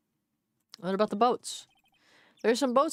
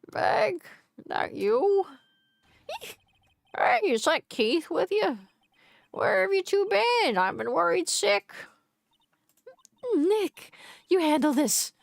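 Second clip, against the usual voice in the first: lightly voiced Maya; 6 semitones higher than usual. Voicing Maya in game.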